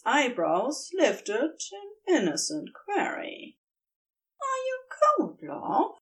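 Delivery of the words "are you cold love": higher angel voice, caring and near tears; tender concern. A narrator's reading. Angel asks lover tenderly.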